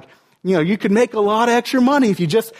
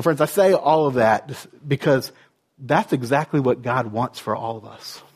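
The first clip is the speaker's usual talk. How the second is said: normal talking voice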